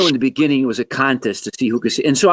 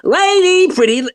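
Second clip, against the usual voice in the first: much higher, louder squeaky Jerry Lewis "Lady"; 4 dB louder than usual. Retold or imitated. Guest showing the host his childhood Jerry Lewis, high and loud. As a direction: yelp it like a goofy Jerry Lewis. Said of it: "I wanted to be Jerry Lewis".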